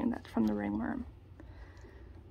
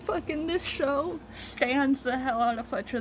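usual speaking voice

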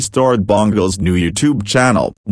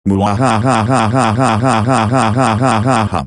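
villain laugh. Acted. Plots revenge, evil laugh.

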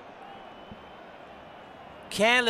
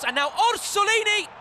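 excited call; 9 semitones higher than usual. Real, not acted. Chance on goal, rising call.

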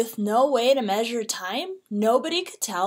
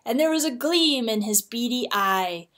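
plain reading voice

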